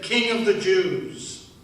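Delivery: mock proclamation. Thief reading the soldiers' sign aloud, slow and mocking. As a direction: announce it big and slow, with scorn. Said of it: "It said".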